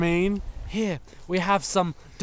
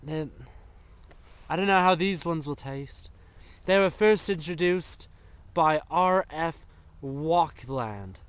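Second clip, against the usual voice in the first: ordinary talk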